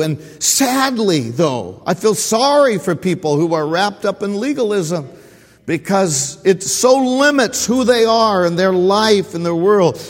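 usual speaking voice

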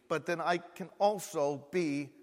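loud, high preacher's build; fervent sermon climax; preaching at full force. Acted. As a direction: preach it with rising fire.

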